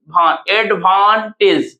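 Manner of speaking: Advantage slowly, lingering on the middle "van"; advantage in parts, long middle syllable; stress on second syllable. Shown as a demonstration. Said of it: "भान में हम ज्यादा समय लगा".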